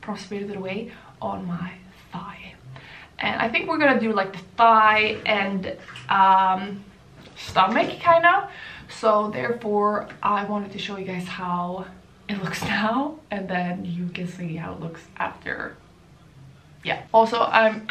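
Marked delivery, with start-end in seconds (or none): 0.0-2.5 whispering. Real, not acted. Quiet aside while filming. 10.8-15.8 whispering. Real, not acted. Aside to camera.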